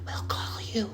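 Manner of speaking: whispered, high brush-off; hushed mock brush-off whisper; dismissive whisper. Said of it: "she was just like".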